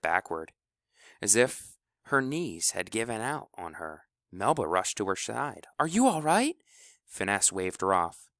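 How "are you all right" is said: high worried question; concerned. Acted. Melba asks her faltering friend, high and worried. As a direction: rush in with alarm.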